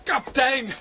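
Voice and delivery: high, loud call across the street. Acted. Friend spotting the captain calls out his name. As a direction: hail him from a distance.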